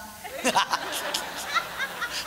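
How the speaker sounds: high breathless giggle at his own joke; high laugh. Real, not acted. Comedian giggling at his suegra joke, breathless high laugh. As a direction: laugh at the punchline.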